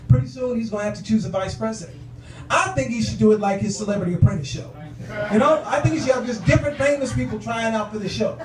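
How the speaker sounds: ordinary talk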